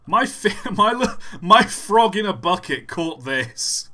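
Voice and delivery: high, loud, laughing disbelief; amazed laughter. Real, not acted. Streamer amazed his frog caught this, laughing loudly. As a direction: stumble over it, loud and laughing.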